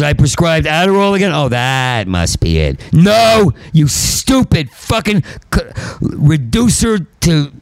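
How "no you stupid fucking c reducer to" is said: high, slow furious insult; angry outburst. Real, not acted. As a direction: snarl the insult slowly.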